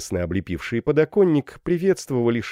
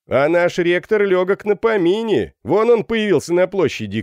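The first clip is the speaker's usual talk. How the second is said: high, loud announcement from the window; shouting; 10 semitones higher, 6 dB louder than usual. A narrator's reading. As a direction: call the news out to everyone. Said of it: "крикнул один из сидевших". (крикнуть с подоконника)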